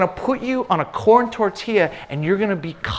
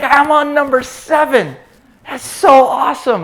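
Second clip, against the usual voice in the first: put-on higher, louder excited kid calling out; excited son; 8 semitones higher, 7 dB louder than usual. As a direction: call across the boat like a thrilled boy. Son gloating in story.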